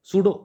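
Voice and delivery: सूडो said as soo-do, the p kept silent; सूडो with no p sound at the start; silent p in pseudo. Shown as a demonstration. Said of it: "पी silent है इसमें".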